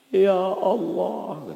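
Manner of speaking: high, long-drawn plea to God; muhasabah prayer leader. Retold or imitated. Ustadz acting his tearful reflection session, drawn-out pleading. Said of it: "Bagian muhasabah".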